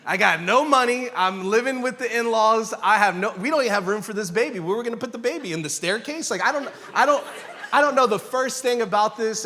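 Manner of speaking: high-pitched comic rant of worry; mock inner panic. Broke husband living with the in-laws, mock panic. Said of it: "Internally, my dialogue is like".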